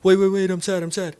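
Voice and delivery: high, loud mock whine of a sad person; mock protest. Sad friend protesting the hug in a high mock whine. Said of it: "they don't even have a chance to go".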